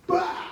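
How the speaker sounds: loud, explosive "bah" for a slap; imitated slap noise. Telling how she slapped him asleep, explosive slap sound. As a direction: burst out the smack of a hand.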